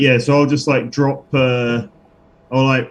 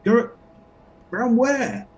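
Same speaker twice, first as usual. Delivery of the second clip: pitched way up, playing dumb; a high innocent question; high character voice; 11 semitones higher, 3 dB quieter than usual. Character asking the bugbear, high put-on voice.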